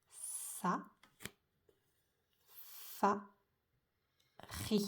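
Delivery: SA, FA, RI, long first consonants and wide pauses; syllable by syllable. Shown as a demonstration. Teacher writing the syllables, slow separated SA, FA, RI. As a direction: sound out SA, then FA, then RI separately. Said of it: "On a trois syllabes".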